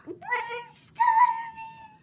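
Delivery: very high, loud, slow wail; hungry friends wailing at the shark; hungry shout. Acted. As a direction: cry out in hunger. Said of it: "said his friends".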